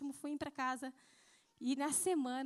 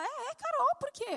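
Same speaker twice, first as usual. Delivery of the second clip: puzzled reply; 11 semitones higher, 4 dB louder than usual. Retold or imitated. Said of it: "Aí ela".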